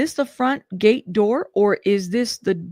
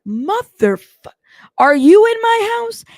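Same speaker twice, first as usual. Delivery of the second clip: much higher, louder shouted demand; shouting; 11 semitones higher, 10 dB louder, slower than usual. Retold or imitated. [name] repeating his question to [name], now shouting. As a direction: let it burst out, furious. Retold angry phone call, repeated.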